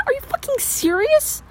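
incredulous. Acted. Clone scoffs incredulous.

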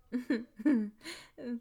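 loud gloating laugh; triumphant laugh. Acted. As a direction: cackle at an easy win.

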